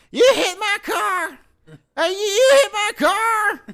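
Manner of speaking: very high, very loud screech, words drawn out; blood-curdling crackhead voice. Retold or imitated. Crackhead woman accusing a driver of hitting her car, blood-curdling screech. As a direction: scream it like a crazed crackhead. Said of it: "blood-curdling crackhead voice".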